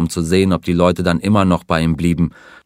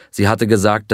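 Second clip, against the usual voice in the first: ordinary delivery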